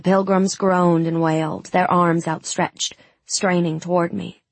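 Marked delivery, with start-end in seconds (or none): none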